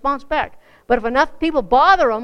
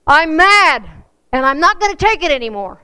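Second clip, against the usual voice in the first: loud angry yell; yelling out the window; 4 semitones higher, 7 dB louder than usual. Retold or imitated. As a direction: shout it out the window, fed up. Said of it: "yelled out".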